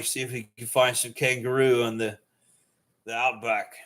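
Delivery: casual put-on Australian accent. Shown as a demonstration. Streamer showing off his voices, casual Australian accent. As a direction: slip into an Australian voice. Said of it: "I like doing the Australian voice too".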